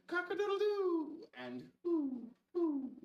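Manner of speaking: very high, loud crow and hoots; crow, then hoot like an owl; rooster and owl. Acted. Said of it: "Let's turn the page and review".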